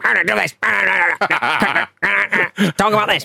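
headset feedback. Retold or imitated. Co-host mimics McMahon heard through commentators' headsets, garbled squawk. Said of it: "The feedback".

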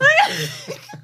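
squealing laugh, high; high squeal of laughter. Real, not acted. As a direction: let out a shrieking laugh. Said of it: "You gotta just laugh".

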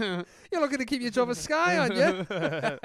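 loud, high Scouse with laughter; bad Scouse accent. Laughing host does an awful Scouse accent. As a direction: ham up a Liverpool pundit. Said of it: "That was an awful accent".

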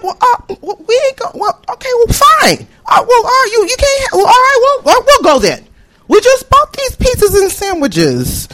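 high, stammering, reluctant giving-in; flustered protest. Acted. Students told to pack up and go, flustered protest. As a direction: stumble through a high-pitched, wounded agreement.

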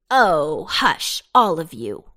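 loud, punchy scolding; witch crying out. A narrator's reading. Witch silencing noisy vegetables, crying out. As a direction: cut them off with a loud hush. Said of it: "cried the witch".

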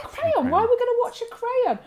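high-pitched, quick mock disbelief; baffled echo. Retelling her confusion to John's dad, high baffled voice. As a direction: query it in high, exaggerated bafflement. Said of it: "I was like".

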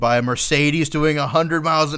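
jingle then a deadpan notice rising to a high loud squeal; mock email voice. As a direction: read out the bad news, climbing to a shriek at the end. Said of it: "and it's like".

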